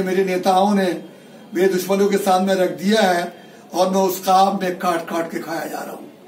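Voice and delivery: ordinary talk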